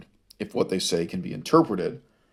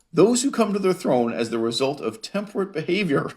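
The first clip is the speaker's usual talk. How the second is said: higher voice, tipping into laughter; amused reading; 8 semitones higher than usual. Real, not acted. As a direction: read it until laughter takes over. Amused by the quote being read.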